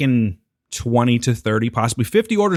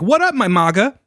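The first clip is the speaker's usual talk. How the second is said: high, loud bro greeting; mock MAGA greeter; 9 semitones higher, 4 dB louder than usual. Joking about a Trumpy coffee shop's greeting. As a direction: hail customers like a hyped MAGA barista.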